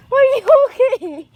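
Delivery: loud high concerned question; worried loud call. Real, not acted. Girl checking on her dog in the dark: loud worried call.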